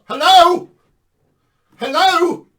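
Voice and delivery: loud, high mocking hello; mock calling out. Preacher mimicking skeptics shouting into the void, mocking. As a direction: call out as if nobody's home.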